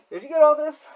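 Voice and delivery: very high, loud silly voice; mock character checking on the viewer; put-on voice. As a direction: ask it in a goofy squeaky character. Odd voice asking the viewer.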